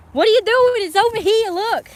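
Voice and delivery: high, loud exasperated scolding; exasperated yelling. Real, not acted. Scolding the dog that can't find it, high exasperated yell. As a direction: shriek it at the dog in frustration. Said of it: "that stupid dog, you know, pissed me off".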